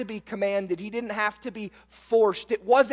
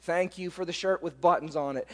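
dutiful thanks; 5 semitones lower, 6 dB quieter, faster than usual. Retold or imitated. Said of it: "turn around and say".

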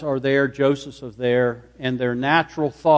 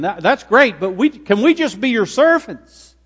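high, louder acted plea; pleading; 8 semitones higher, 5 dB louder than usual. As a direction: beg earnestly, voice climbing well up. Voices brothers pleading to be servants.

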